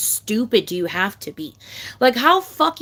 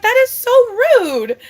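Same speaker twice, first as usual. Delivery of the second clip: retold outrage; 13 semitones higher, 7 dB louder, slower than usual. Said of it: "I was like".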